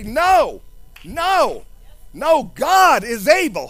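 declaring faith. Retold or imitated. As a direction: proclaim it against doubt. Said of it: "You say".

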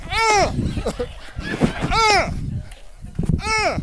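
laughing. Real, not acted. Preacher laughing high after skit.

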